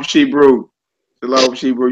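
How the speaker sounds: normal talking voice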